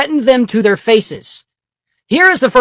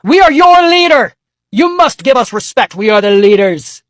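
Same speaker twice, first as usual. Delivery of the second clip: arrogant Trump; 2 semitones higher, 4 dB louder than usual. Retold or imitated. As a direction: proclaim it like a self-crowned king. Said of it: "that's how Donald Trump views himself".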